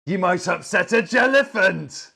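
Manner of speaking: high, loud storybook exclaim; excited punchline. Acted. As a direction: burst out with the book's big warning. Punchline of a children's book read aloud.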